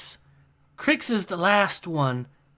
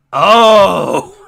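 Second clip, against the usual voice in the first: shocked exclamation; 2 semitones higher, 12 dB louder than usual. Real, not acted. Shocked reaction to chat.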